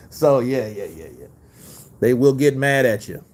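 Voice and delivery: normal talking voice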